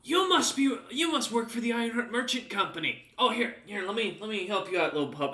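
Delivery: a guard amused by a dog with a sword. Acted. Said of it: "Looks at you and goes".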